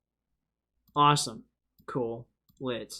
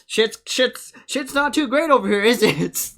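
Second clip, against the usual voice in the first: high, loud comic outburst; mock-alarmed exclamation; 8 semitones higher, 10 dB louder than usual. Joking exclamation about the country.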